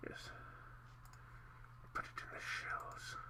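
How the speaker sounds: breathy whispered aside. Real, not acted. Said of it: "whispered aside".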